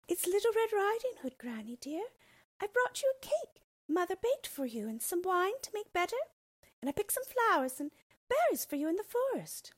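put-on high, sweet voice of a small girl; a cheerful little girl calling through the door. Little girl greets granny.

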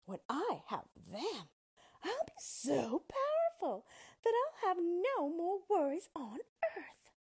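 slow witch voice climbing high and loud; drawn-out, rising witchy boast; scheming witch. A narrator's reading. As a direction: gloat over coming power as a witch. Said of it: "the witch said to herself".

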